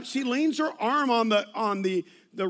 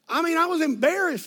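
ordinary delivery

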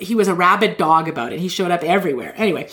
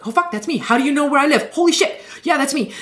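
high, amazed gasping reply; stunned audience member; 7 semitones higher than usual. Retold or imitated. Audience man amazed the healer knows his address, high and stunned. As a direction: sound floored that a stranger knows you.

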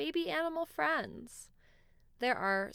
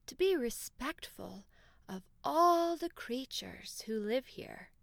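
wise owl voice. Acted. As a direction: whisper the forest's secret wisely. Wise owl gives advice.

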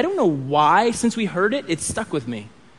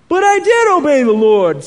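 higher, louder protest as Saul; insistent; 8 semitones higher, 8 dB louder than usual. A narrator's reading. Saul defends himself to Samuel, insistent. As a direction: insist in your own defence, voice pushed up. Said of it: "Saul insisted".

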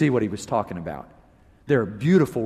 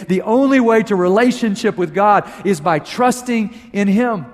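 raised, driving preacher's voice; full preaching; 7 semitones higher, 5 dB louder than usual. Acted. Pastor challenging his congregation, full force. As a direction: press the challenge home to the church.

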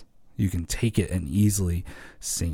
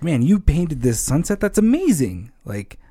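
delighted, higher-pitched fatherly gush; excited proud dad; 7 semitones higher than usual. Retold or imitated. A father praising his child's painting, delighted. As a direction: gush over a child's drawing. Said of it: "And he's like".

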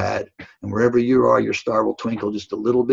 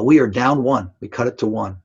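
normal talking voice

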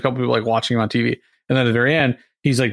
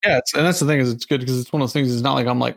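ordinary delivery

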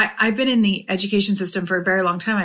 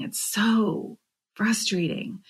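breathy whisper, drawn out slowly; whispered frustration; 6 dB quieter, slower than usual. Real, not acted. Vlogger venting to her audience, soft slow whisper.

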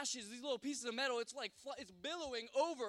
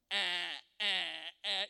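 loud, lower blaring bleats; smoke alarm; 5 semitones lower, 6 dB louder than usual. Retold or imitated. Whole house alarm system going, imitated loud beeps. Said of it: "the whole house is going".

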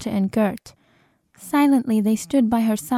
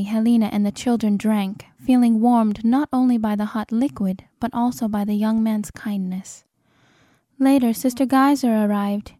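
usual speaking voice

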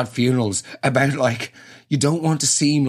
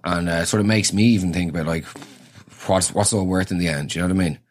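mock grief; 7 semitones lower than usual. Mock grieving mourner, low and subdued.

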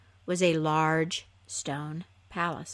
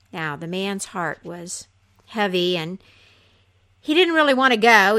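plain reading voice

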